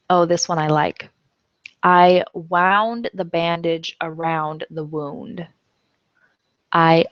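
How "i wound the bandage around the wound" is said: "wound" first rhymes with round, then woond; x0.6 slow, both "wound" sounds clear; teacher reading slowly; slow homograph demo. Shown as a demonstration. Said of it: "Wound is past tense of wind".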